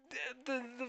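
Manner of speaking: normal talking voice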